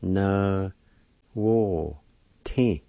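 "Na" "wo" "ti" said as separate syllables with pauses. Shown as a demonstration. Teacher sounding out the word for students, syllable by syllable. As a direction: break it into syllables. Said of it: "First part is pronounced like this".

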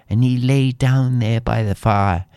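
usual speaking voice

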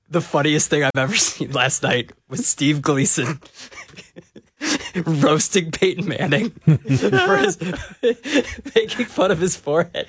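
real giggles breaking into the words; laughing. Real, not acted. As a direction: struggle to tell it through laughter. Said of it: "more than I'm laughing now".

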